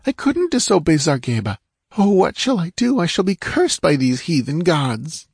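high, pleading and scared; frightened girl. A narrator's reading. Captive girl pleads with Conan, high and afraid. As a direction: plead as if cornered. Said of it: "quivering with abject fright".

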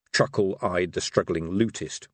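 usual speaking voice

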